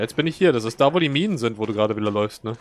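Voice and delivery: usual speaking voice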